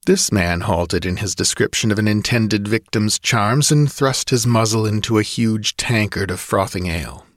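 ordinary delivery, nothing marked

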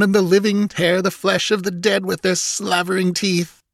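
a high, gleeful fiend watching slaughter; gloating priest. Acted. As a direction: relish each kill like a laughing fiend, pitched high. Narrator voices gloating priest.

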